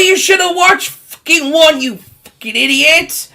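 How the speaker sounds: ordinary delivery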